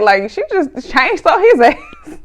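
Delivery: can't keep a straight face, cracking up; laughing. Real, not acted. Laughing at the show scene.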